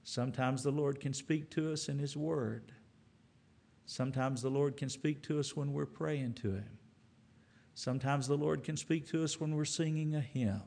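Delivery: usual speaking voice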